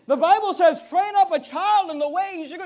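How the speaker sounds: high, loud pulpit proclamation; preaching at full force. Acted. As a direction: proclaim the verse to the room.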